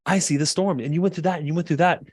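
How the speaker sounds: high, emphatic show of understanding; validating husband. Acted. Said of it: "she wants you to be like".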